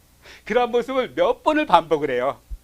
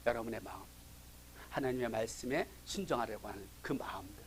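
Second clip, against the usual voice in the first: whispered, softer, intimate emphasis; soft whispered appeal; hushed whisper; 8 semitones lower, 15 dB quieter than usual. Real, not acted. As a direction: drop to a whisper so they lean in. Preacher drops to hushed intimacy.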